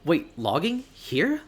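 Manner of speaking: rising, high disbelieving question; shocked disbelief. Acted. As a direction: blurt it out in shock. Shocked at logging.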